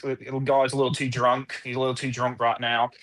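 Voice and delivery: mimicking an Australian lifeguard; casual Aussie lifeguard reporting a drunk; Australian accent. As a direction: put on an Australian beach-patrol accent. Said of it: "lifeguard show that takes place in Australia".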